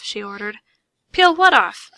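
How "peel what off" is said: higher, much louder question; disbelieving question. A narrator's reading. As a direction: blurt it in shock. Narrator asks in disbelief.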